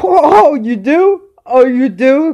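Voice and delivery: drawn-out, high-pitched mock surprise. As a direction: play amazed at the challenge, voice shooting up. Mock reply to the challenger.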